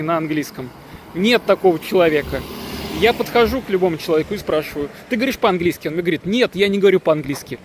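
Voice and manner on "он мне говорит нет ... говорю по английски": ordinary talk, nothing marked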